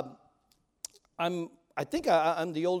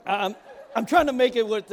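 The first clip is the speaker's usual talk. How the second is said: laughing; 7 semitones higher, 10 dB louder than usual. Real, not acted. Laughing after teasing.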